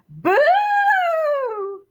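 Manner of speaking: high, loud ghostly boo; spring out with a boo; spooky boo. Acted. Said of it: "lifted up his pillowcase and said".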